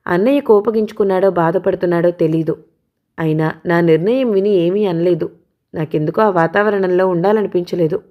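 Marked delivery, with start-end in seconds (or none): none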